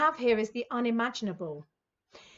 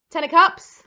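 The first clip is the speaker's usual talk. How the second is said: loud, proud card calls; gleeful announcement; 3 semitones higher, 10 dB louder than usual. Real, not acted. Reader naming cards to Scorpios, loud and gleeful. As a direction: announce each card like a prize.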